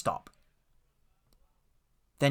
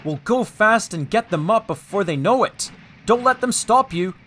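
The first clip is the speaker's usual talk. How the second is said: urgent, raised-voice coaching; warning; 7 semitones higher, 4 dB louder than usual. A narrator's reading. Driver urging James at the hill, warning urgently. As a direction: spur them on, high and louder. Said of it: "warned his driver".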